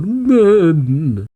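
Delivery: high, drawn-out mock cry; imitated high-pitched holler; Ichiya crying out. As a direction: wail it out as a long catchphrase. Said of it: "And he cries out".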